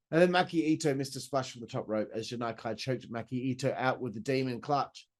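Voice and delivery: ordinary talk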